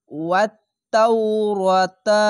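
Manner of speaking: slow, drawn-out chanted recitation; Quran recitation. Shown as a demonstration. Teacher to students, slow drawn recitation. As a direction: recite it slowly with tajweed. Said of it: "Quran recitation demo".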